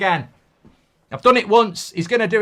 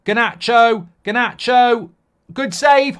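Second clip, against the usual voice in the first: usual speaking voice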